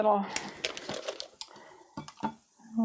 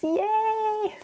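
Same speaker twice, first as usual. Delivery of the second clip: high, drawn-out squeal of glee; gleeful high-pitched yay; little cheer; 12 semitones higher than usual. Real, not acted. Cheering at trying watercolor sheets.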